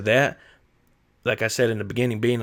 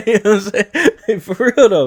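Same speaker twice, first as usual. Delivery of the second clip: high, loud real laughter; laughing hard; 11 semitones higher, 9 dB louder than usual. Real, not acted. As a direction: cracks up at the memory. Speaker cracking up.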